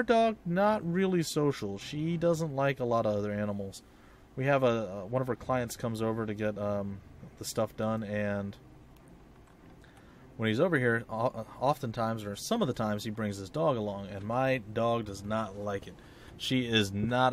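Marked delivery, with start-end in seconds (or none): none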